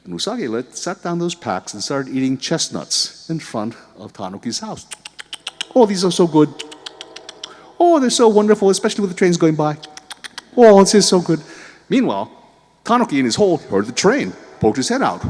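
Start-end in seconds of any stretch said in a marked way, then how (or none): 5.8-11.4 loud, high, showy enjoyment; rabbit voice. Acted. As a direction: tempt him with loud delight. Rabbit taunting with chestnuts.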